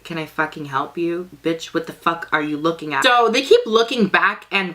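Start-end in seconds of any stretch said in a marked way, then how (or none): 0.0-3.0 muttered attitude. Retold or imitated. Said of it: "I'm just like".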